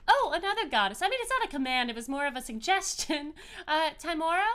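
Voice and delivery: bubbly informal deity; joyful goddess, casual. Acted. Game master voices the goddess.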